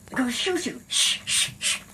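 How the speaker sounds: urgent whispered warning; imitated alarmed shushing. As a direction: hush her like frightened onlookers. Onlookers hushing her in fear.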